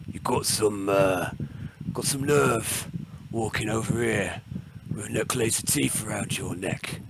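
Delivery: a hint of a gruff orc boss, lightly voiced; manly orc voice. Big orc challenges the stranger, lightly voiced manly orc. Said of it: "most manly orc voice".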